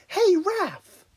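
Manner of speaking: high, bright greeting; chirpy cheerful hello. Acted. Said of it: "Donatello said cheerily".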